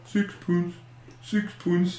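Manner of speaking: soft mock-Swedish murmur; Swedish Chef. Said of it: "Meanwhile, just going".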